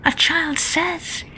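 hushed whisper, passing it along. Acted. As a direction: whisper it to your neighbour. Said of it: "one person whispered to another".

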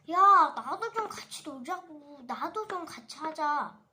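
loud demand, stress on 좀; angry plea. Pretend. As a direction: yell to be let in, hitting 좀. 인형이 같이 놀자고 항의. (같이 놀자고 크게 항의함)

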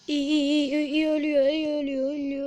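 loud, rapid egging on; excited cheering. Real, not acted. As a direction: cheer the kill on. Enemy about to die in game. (heyecanlı tezahürat)

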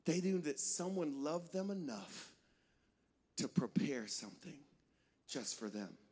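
soft, low, unhurried; tender. Real, not acted. Preacher reflects on guests being loved, tender and hushed. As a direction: let it sink in softly and low.

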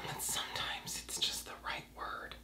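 hushed, confiding whisper; whispered aside. Real, not acted. Defending a rude word to viewers, whispered. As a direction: whisper it like a guilty admission.

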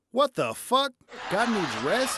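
high, loud what-the-hell disbelief; incredulous outburst. Real, not acted. Comic to audience, loud high disbelief. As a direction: shout your disbelief. Mock outrage at God resting.

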